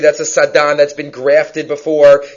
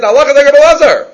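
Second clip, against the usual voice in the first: proclaimed higher and louder; proclaiming; 7 semitones higher, 6 dB louder, faster than usual. Retold or imitated. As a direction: ring it out like a voice from heaven. Heavenly voice proclaims the ruling.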